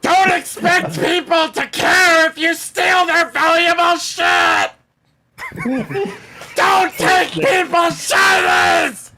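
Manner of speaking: high, loud yell after someone; in-character angry shout. Acted. Character yelling at a thief walking off, furious acted shout. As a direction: holler the rebuke at full volume.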